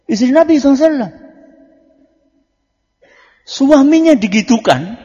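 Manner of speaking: loud, high preaching burst; loud fiery preaching. Acted. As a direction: raise the voice hard to stir the crowd. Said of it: "preacher raises voice, builds".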